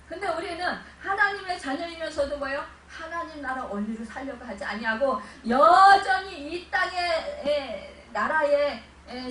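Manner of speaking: loud impassioned preaching; fervent preaching. Real, not acted. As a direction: drive it home like a revival preacher. Preaching at full force. (크게 외치는 열정적인 설교)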